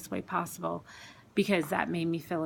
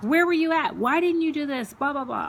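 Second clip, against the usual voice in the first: much higher, loud demanding cry; yelling at God; 8 semitones higher, 5 dB louder than usual. Retold or imitated. Woman reliving shouting her anger at God, loud high cry. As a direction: hurl the questions at God. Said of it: "crying and yelling at God".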